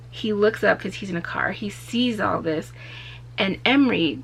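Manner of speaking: ordinary talk, nothing marked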